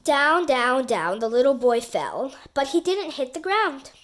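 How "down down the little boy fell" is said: ordinary delivery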